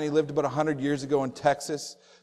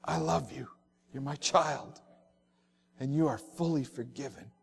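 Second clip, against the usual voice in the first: hushed and gentle, much softer; soft voice of God; 4 semitones lower, 9 dB quieter than usual. Pretend. God reassuring his forgiven children, softly and tenderly. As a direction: murmur it like a father to his child.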